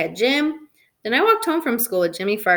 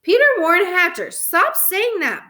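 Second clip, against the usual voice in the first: full-name telling-off, raised voice; hollering; 8 semitones higher, 4 dB louder than usual. A narrator's reading. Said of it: "Mom hollered".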